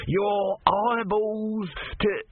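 high, slow, strange voice; cursing tortoise god. Acted. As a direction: hurl the curse up from the basket.

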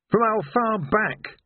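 high, slow townsman voice; Ned Coates voice. A narrator's reading.

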